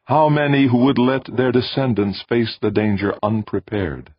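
high, solemn alien voice. A narrator's reading. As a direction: lecture like a distant being. Alien voice speaks.

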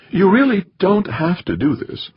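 high, put-on female voice; protesting wife. A narrator's reading. Wife protests to departing husband.

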